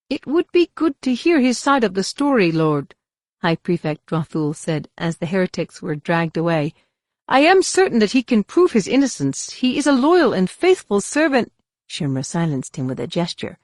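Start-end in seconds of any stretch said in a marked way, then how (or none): 0.1-2.9 high, anxious courtier voice; a nervous prefect pleads with his lord in a high voice; pleading official. A narrator's reading. 7.3-11.5 high, anxious courtier voice; a nervous prefect pleads with his lord in a high voice; pleading official. A narrator's reading.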